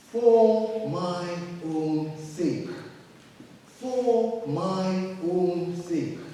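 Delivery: "For my own sake" drawn out very slowly, each word weighted; slow emphatic repetition. Shown as a demonstration. Preacher reading Isaiah slowly for emphasis. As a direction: lean hard on the repeated "for my own sake". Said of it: "It has a repetition for emphasis".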